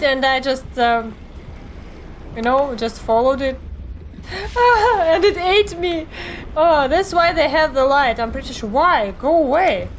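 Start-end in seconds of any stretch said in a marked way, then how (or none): 4.3-6.1 laughing. Real, not acted. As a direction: laugh breaking into the words. Laughing after being eaten.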